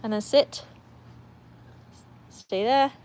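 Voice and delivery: ordinary delivery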